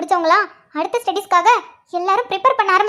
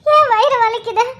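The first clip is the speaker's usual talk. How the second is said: higher-pitched, tearful moaning; crying in pain; 3 semitones higher, slower than usual. Acted. Pregnant wife left waiting with worsening pains, crying in pain. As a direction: sob through the cramps.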